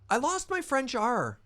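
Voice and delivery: high, loud-ish, drawn-out comic wail; squeaky, exaggerated lament; mock dismay. Real, not acted. Mock dismay at lost French R.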